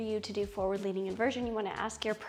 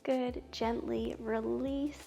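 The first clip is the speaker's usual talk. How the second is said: soothing yoga teacher; 6 dB quieter, slower than usual. Acted. Guides yoga poses slowly.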